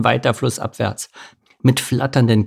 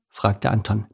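plain reading voice

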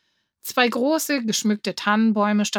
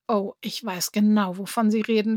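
ordinary delivery, nothing marked

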